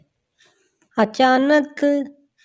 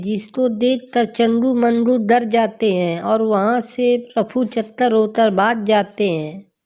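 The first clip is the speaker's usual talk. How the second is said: usual speaking voice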